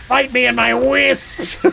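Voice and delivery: loud, slow, high creature wail; hammed-up monster voice, words drawn out; Wisp mother voice. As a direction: screech a challenge like an angry spirit. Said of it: "That's what I think she sounds like".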